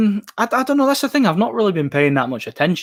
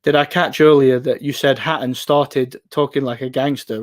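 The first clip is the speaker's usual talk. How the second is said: ordinary delivery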